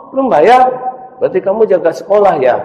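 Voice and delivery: high teacher voice. Acted. Teacher teases the boy who did not pay, high and fast.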